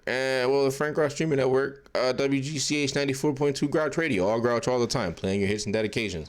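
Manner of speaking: loud, booming mock DJ station ident; playful fake radio host intro; radio announcer. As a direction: sell it like a radio jingle read.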